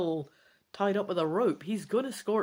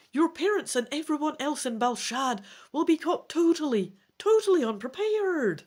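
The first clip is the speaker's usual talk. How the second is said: a lightly voiced elf, rising higher and louder as the panic builds; urgent elf companion; 5 semitones higher, 5 dB louder than usual. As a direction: urge your friend to hurry before the danger hits. Elf companion urging, warning of danger.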